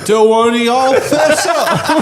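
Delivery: high, laughing put-on judge voice; mock judge. Friend mocks a judge scolding the whole room, giggling.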